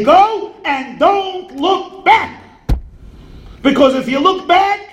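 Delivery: high, slow and pleading; slow urgent plea. Acted. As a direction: push yourself out the door, drawn out. Urging himself to move on.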